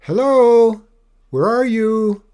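high, very loud searching call; female voice calling. A narrator's reading. Mother dragon looks for her child, high loud call. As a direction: call across the distance for someone. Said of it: "a female voice, sliced through the gathering gloom".

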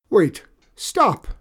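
high loud panicked yelling; panicked shout. Acted. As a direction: yell while being chased. Enchanter chased by horse.

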